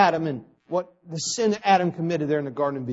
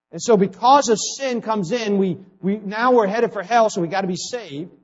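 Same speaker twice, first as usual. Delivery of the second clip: ordinary talk, nothing marked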